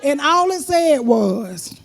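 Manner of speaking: ordinary delivery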